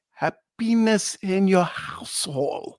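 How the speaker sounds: slow, heavily put-on French-Canadian accent; mock French-Canadian accent. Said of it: "but they say".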